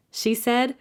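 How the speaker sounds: plain reading voice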